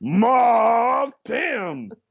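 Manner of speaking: very slow, high, stretched-out name; drawn-out announcer call. Retold or imitated. Emcee bringing his friend on stage, drawn-out shout. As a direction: hail the act with long held vowels.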